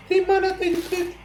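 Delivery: cartoonish outraged squeal; squealed outrage. Real, not acted. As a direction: screech it in disbelief. Outraged at the cheese again.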